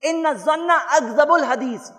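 slow, solemn Arabic recitation; slow devotional Arabic chant; reciting hadith. Acted. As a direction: intone the hadith slowly and reverently. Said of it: "نبی صلی اللہ علیہ وسلم نے فرمایا".

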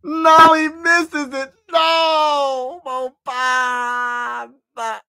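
very high, loud shout of despair; anguished shout. Real, not acted. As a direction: scream it as the shot rims out, dragging the name. Reacts to missed free throw.

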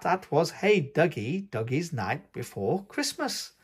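ordinary delivery

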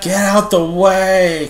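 mock shout. Shouting at the officer NPC.

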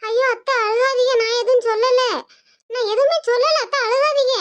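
daughter-in-law begging mother-in-law not to cry; pleading daughter-in-law. Acted. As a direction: plead with her to stop crying. Daughter-in-law soothing crying in-law.

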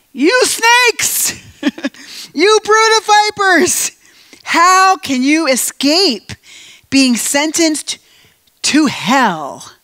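high, loud, slow condemning cry; fierce rebuke. Acted. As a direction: hurl the insult at the hypocrites. You drop down a little bit.

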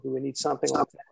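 usual speaking voice

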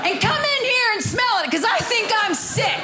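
high, bright mock husband call. Husband calling to his wife, a mock call. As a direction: voice the husband shouting from the bathroom.

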